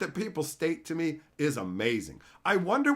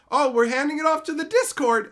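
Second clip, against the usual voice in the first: mock corporate excuse; 10 semitones higher, 8 dB louder than usual. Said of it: "some organizations are like".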